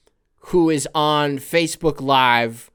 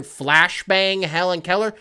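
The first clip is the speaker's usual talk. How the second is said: usual speaking voice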